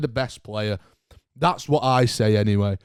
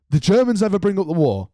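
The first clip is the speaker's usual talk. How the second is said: incredulous; 6 semitones higher, faster than usual. Real, not acted. Repeating tweet in disbelief.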